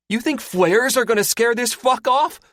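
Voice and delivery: very high, loud scornful shout; angry shout. Acted. Gunner shouts back at the pilot, scornful. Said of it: "Athos shouts".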